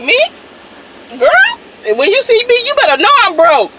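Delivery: high, loud sassy comeback; sassy exclaim. Retold or imitated. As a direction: laugh off the idea that she has money. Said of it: "I'm like".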